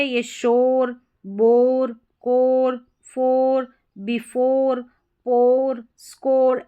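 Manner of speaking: teacher modelling शोर, बोर, कोर, फोर with a long O; model word reading. Shown as a demonstration. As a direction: read the ORE words out for the class to repeat. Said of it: "तो यहाँ पर S H जो है ना".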